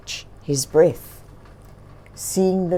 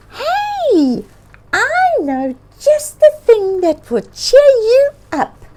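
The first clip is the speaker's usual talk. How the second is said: high, louder, eager bird voice; cheerful bird; 7 dB louder than usual. A narrator's reading. Bird promising to cheer up the bear, high and eager. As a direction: pipe up high with a bright idea. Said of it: "Bird said".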